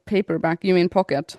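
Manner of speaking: normal talking voice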